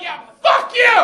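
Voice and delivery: screamed high and loud, fed up; furious shouted comeback; defiant shout. Pretend. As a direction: yell it right back in his face. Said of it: "you should be like".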